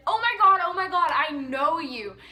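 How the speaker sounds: loud, excited mock recognition; fake fan gushing. Running up to strangers as a prank, gushing. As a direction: gush as if spotting someone famous.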